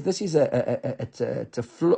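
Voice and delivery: stuttering, stuck on a word; halting stutter. Real, not acted. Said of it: "I was stuttering my way through that run".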